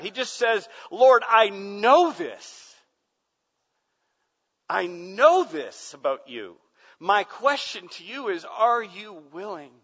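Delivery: loud, high, impassioned preacher voice; full-force pulpit cry; fiery preaching. Acted. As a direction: preach it to the back row. Preaching climax.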